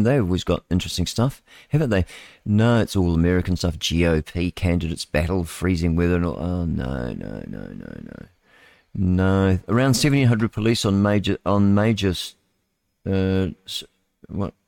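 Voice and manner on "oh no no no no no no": fading mock no. Groans at the news item.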